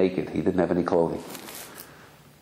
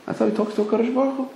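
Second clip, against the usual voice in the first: ordinary delivery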